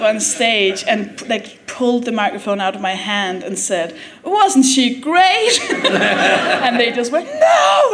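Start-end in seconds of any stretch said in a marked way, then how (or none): 4.2-5.6 high, slow, sarcastic host's praise; mock cheerful praise. 7.3-7.9 much higher, loud mock yell; crowd yelling no. Crowd shouting back at MC.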